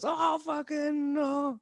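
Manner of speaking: high, soft, drawn-out collective groan; audience groan. Retold or imitated. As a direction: imitate a crowd slowly groaning in protest. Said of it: "the audience just goes".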